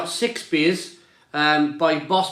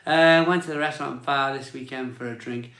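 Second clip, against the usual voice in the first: ordinary talk, nothing marked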